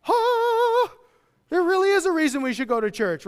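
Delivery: high, loud burst of mock wonder; hammed-up churchgoer amazed at church; sudden delight. Churchgoer moved by sermon.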